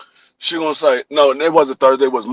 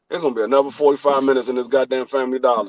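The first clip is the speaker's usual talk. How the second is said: muttered complaint; 7 semitones lower, 7 dB quieter, much faster than usual. Retold or imitated. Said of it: "I'm like".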